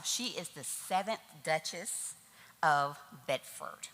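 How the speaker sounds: normal talking voice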